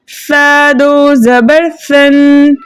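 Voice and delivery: slow, level chant with stretched syllables; spelling-out recitation. Shown as a demonstration. Teacher reads spelling and blend together for pupils, slow chanted drill. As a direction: draw out each syllable and hum the final n. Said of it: "जोड़ो रवा के साथ पढ़ते हैं".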